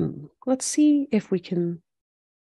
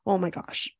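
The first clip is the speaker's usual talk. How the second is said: ordinary delivery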